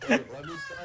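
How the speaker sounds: straining high. Real, not acted. Straining to reach high pitch.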